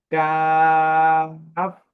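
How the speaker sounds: কাফ with a thin sound, long-held vowel; thin-sounding letter. Shown as a demonstration. Teacher models the letter name, thin, drawn-out কাফ. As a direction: draw out কাফ thinly on a level note. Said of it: "খুব পাতলা হবে আওয়াজটা".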